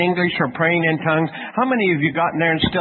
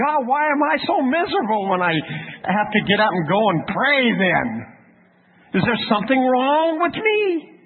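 high-pitched mock pleading; hammed-up whine, rising high on the last question; plaintive complaint; 8 semitones higher than usual. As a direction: plead with God, bewildered. Acted complaint to God.

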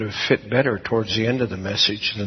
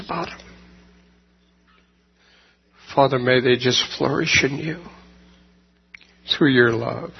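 closing prayer. Real, not acted. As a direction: pray it over the congregation, unhurried. Pastor leads closing prayer.

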